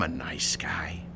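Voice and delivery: soft, low whisper. Acted. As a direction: drop to a whisper close to his ear. Landlord leans in on tenant.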